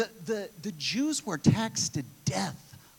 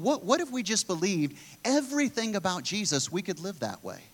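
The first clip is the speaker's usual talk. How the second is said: ordinary talk, nothing marked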